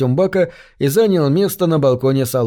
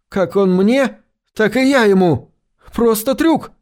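yelling; 5 semitones higher than usual. Acted. Gates keeps yelling his confession.

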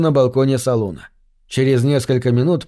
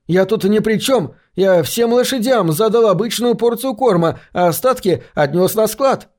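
shouting; 7 semitones higher than usual. Acted. Stable boy protests his innocence.

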